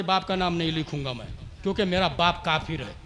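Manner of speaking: low, very soft, quick retelling; quiet quoted refusal. Retold or imitated. Quoted refusal, first told quietly. (धीमी, दबी आवाज़ में इनकार)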